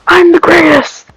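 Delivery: high, loud panicked scream, rushing; screaming villain. Acted. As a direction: shriek it in disbelief as the villain loses. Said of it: "starts screaming".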